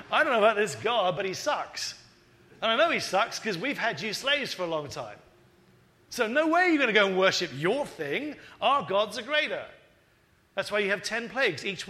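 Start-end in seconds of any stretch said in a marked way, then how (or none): 0.1-9.7 loud, dismissive mock tyrant; scornful Pharaoh. Pharaoh scoffing at Moses, loud and contemptuous. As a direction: Pharaoh jeering at the God of his slaves, loud and scornful. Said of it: "And Pharaoh's attitude is".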